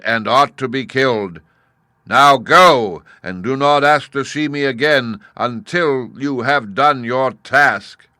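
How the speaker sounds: booming, slow, commanding head of Oz; loud, solemn wizard head laying down the terms; great head of Oz. Acted. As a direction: loom over the girl like a giant talking head.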